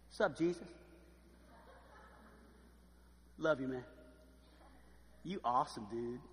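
low, soft, lightly voiced slouching buddy; casual murmur to a close friend; laid-back buddy. Lazarus lounging beside Jesus at the table, laid-back.

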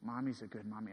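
put-on low, soft quote of a small child; subdued retelling of a daughter's answer; retold child's reply. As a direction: say it quietly, voice dropped. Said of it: "and she said".

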